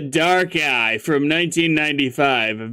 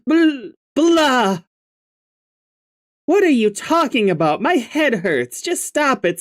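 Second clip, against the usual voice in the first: put-on higher, brighter girl character, annoyed; Jade voice; 10 semitones higher than usual. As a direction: voice a cranky young girl, a bit higher.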